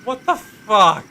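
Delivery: ordinary talk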